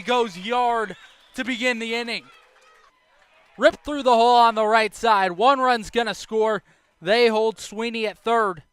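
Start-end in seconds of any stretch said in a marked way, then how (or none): none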